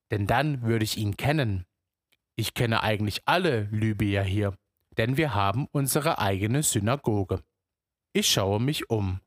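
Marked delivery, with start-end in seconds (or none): none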